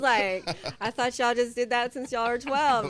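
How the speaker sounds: laughing. Real, not acted. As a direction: tease while laughing. Mocking remark, laughing.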